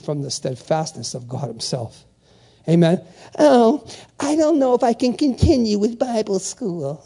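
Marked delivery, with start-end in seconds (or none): 3.4-7.0 mock a reluctant quitter, voice pushed up; whiny weirdo. Student wavering about Bible school, mock whiny weirdo. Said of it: "you sound like a weirdo".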